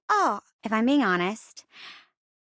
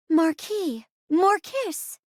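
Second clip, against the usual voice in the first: slow, higher, each word drawn out; theatrical title reveal; 4 semitones higher, 3 dB louder, much slower than usual. Acted. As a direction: present the romance title slowly with a flourish. Host announces a romance title.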